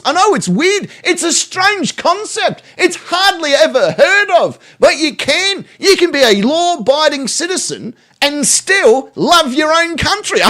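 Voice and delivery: high-pitched mock amazement; sarcastic astonishment. Streamer lecturing violent patriots, in high sarcastic wonder. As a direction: explain the obvious in a high, incredulous voice.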